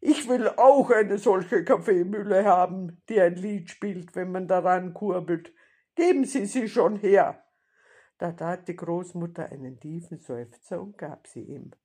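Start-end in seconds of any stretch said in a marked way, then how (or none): 0.0-7.4 loud, demanding bandit voice; growling robber. A narrator's reading. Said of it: "knurrte der Räuber Hotzenplotz".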